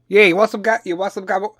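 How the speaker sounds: loud, high East Coast Jersey guy; Jersey accent. Acted. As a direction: put on a pushy Jersey Italian-American voice. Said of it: "Jersey East Coast accent".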